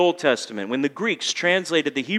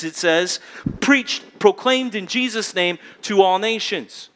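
preaching; 5 semitones higher than usual. Acted. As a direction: drive the call to repent home from the pulpit. Preaching at full force.

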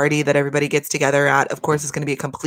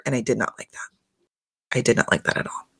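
hushed, low whisper of disapproval; 6 semitones lower, 3 dB quieter than usual. Real, not acted. Vlogger whispering her disapproval to viewers, hushed. Disapproving aside about Paige's heartbreak.

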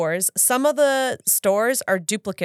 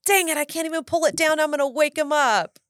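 higher, fast, mock-exasperated inner voice; frustrated grumble; 8 semitones higher than usual. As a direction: rattle it off as a stuck, annoyed passenger. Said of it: "I'm like".

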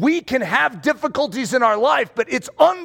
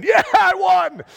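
high, drawn-out victory yell; triumphant cheer; 10 semitones higher, 4 dB louder, slower than usual. Acted. Last one standing at the wall sit, triumphant cheer. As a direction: crow it like a mock champion.

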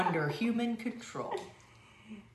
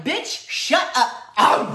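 slow, loud, much higher roar of a shout; lion's shout; 6 semitones higher, 10 dB louder, much slower than usual. Pretend. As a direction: bark it slowly like an angry lion. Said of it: "the lion's like".

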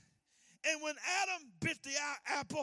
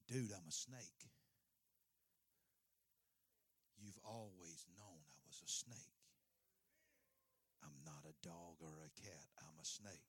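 a snake with no remorse; snake's cold voice; 18 dB quieter than usual. Acted. Snake answers the dying owner, low and unbothered.

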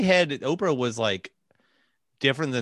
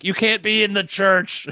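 mock outburst; 7 semitones higher, 4 dB louder, slower than usual. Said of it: "he was just saying stuff like".

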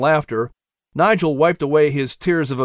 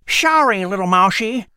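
high whistling squirrel voice; whistle through teeth; 6 semitones higher than usual. Acted. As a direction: lisp the words through buck teeth. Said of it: "with a pronounced whistle through his teeth".

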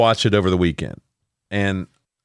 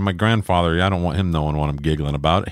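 ordinary talk, nothing marked

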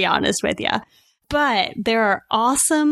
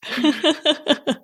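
high, amused laugh; laughter; 5 semitones higher than usual. Real, not acted. Host laughing with guest after mock outburst, amused laughter. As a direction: laugh along at the shared exasperation.

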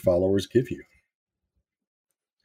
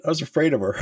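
laughing; 9 semitones higher, much faster than usual. Real, not acted. Laughing at own fear.

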